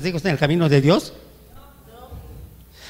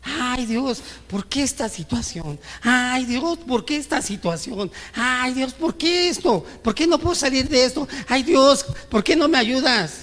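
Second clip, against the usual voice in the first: mock whining to God; 6 semitones higher than usual. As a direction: whine at God, building up to a desperate plea. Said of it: "Reclamarle a Dios".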